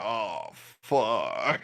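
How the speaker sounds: drawn-out retold "oh, fuck"; slow mock groan of realization; dazed reaction. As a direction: stretch it out, reenacting a dazed player. Retells reaction after a football hit.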